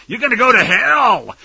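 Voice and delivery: ordinary talk, nothing marked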